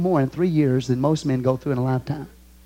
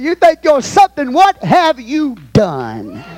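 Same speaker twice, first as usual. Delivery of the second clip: high, loud demand, last words drawn out; indignant challenge; 8 semitones higher, 5 dB louder, slower than usual. Acted. Apostle challenging self-important rivals, indignant and loud. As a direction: thunder it as the apostle, slowing at the end. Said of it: "You've gotta get the inflection of his voice".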